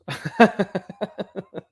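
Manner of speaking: high run of chuckles; real laugh. Real, not acted. Host joking about spiders, real laughing. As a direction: laugh at your own joke.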